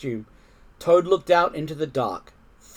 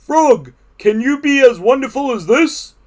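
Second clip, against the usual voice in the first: high, loud showing-off voice; boastful Toad; 9 semitones higher, 9 dB louder than usual. A narrator's reading. Toad boasts to Frog while dancing, high loud brag. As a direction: challenge a friend proudly and loudly.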